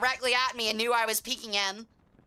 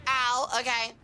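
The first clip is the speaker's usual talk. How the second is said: usual speaking voice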